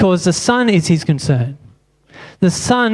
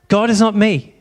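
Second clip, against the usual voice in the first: usual speaking voice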